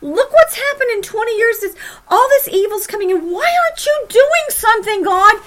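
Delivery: high, loud demanding plea; impatient prophet crying out to God; prophet's complaint. Retold or imitated. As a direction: press God for an answer. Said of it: "Habakkuk is seeming to say to God".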